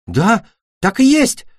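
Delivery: high, drawn-out cry of hope; excited exclamation. A narrator's reading. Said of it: "воскликнула Беда". (радостное восклицание, высоким голосом)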